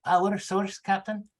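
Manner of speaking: lightly voiced confused engineer; bewildered. Engineer baffled by the captain's order, mildly bewildered. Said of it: "a tone of utter bewilderment".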